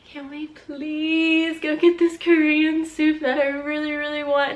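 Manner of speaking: high, pleading little request. Retold or imitated. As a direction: beg sweetly for the soup. Begging husband for Korean soup.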